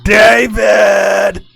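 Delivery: long loud shout, drawn out; shouting a name. Acted. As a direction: yell his name across the house. Mother calling out for husband.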